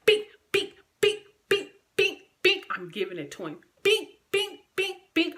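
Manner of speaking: high, loud bink punches; punch sound effects. Retold or imitated. Retelling the fight, loud squeaky punch noises.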